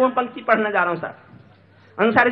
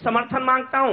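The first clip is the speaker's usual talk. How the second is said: normal talking voice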